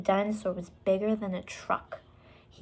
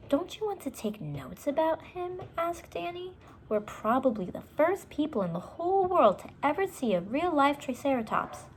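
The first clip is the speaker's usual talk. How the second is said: put-on little girl voice; 8 semitones higher than usual. Annie coaxing Jack.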